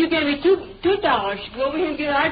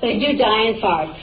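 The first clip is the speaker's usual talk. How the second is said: ordinary talk, nothing marked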